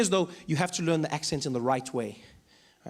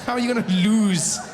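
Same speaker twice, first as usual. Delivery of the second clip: ordinary talk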